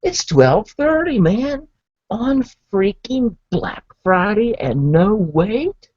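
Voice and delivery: can't believe there's no wait; amazed exclaim. Retold or imitated. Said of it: "And I'm like".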